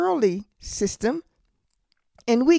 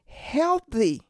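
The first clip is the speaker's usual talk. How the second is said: ordinary delivery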